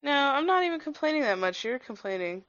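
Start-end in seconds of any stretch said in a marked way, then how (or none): none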